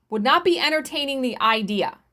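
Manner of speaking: ordinary delivery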